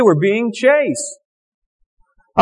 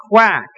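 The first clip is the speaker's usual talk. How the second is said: duck quack. Acted.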